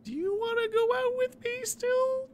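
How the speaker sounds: high, slow squeaky ask. Acted. Absurd date proposal.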